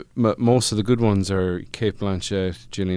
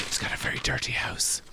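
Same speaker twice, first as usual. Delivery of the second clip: hushed, whispered jibe; whispered aside; 2 semitones higher, 12 dB quieter than usual. Real, not acted. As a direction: whisper a catty aside under your breath. Muttered aside mocking the host.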